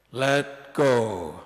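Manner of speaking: low, loud, unhurried command; God's voice. Acted. God telling the man on the branch, booming and low. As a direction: boom it down calmly from above. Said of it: "he heard God say".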